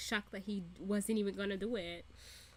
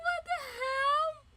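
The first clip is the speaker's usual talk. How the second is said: slow, very high comic squeal; shocked disbelief; 3 dB louder, slower than usual. Retold or imitated. Fan reacting to the burned laptop, squealed disbelief. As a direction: squeal it, amused and aghast.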